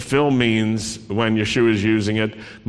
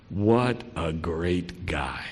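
wry aside; 6 semitones lower, 7 dB quieter, slower than usual. Real, not acted. Sardonic aside after praise.

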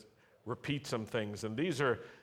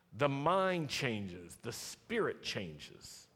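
slow emphasis; much slower than usual. Acted. Preacher slowing for effect.

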